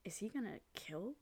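hushed whisper of suspicion. Retold or imitated. Host whispering a suspicion to co-host about the hero. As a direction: whisper the dark guess. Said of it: "Did you get that feeling?".

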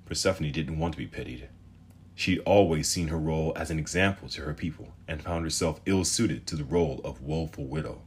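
usual speaking voice

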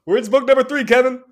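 loud high plea; shouting. Real, not acted. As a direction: yell it out to the author. Pleading shout at absent author.

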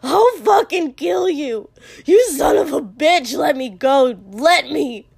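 high, very loud enraged threats; screaming. A narrator's reading. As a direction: scream it while fighting to get loose. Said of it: "but Katsuki was screaming".

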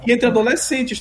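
ordinary talk, nothing marked

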